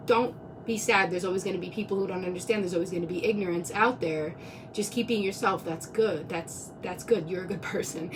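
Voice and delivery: soft, low reassurance; calm her down gently, almost under your breath; gentle comfort. Retold or imitated. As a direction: friend soothing her after the police stop, softly and low. Friend comforting her after police stop.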